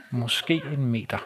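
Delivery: slow, low and soft repetition; hushed, drawn-out disbelief; deadpan repeat. Real, not acted. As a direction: let the absurdity sink in, very slowly. Repeats absurd answer for effect.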